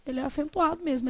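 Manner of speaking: ordinary talk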